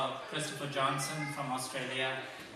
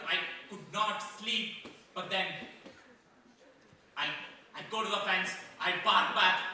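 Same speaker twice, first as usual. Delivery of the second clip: weary, aggrieved dog recounting his ordeal; worn down, with bursts of indignation; Boris; 3 semitones higher, 3 dB quieter than usual. Acted. Rottweiler tells of digging all day, sore and exasperated.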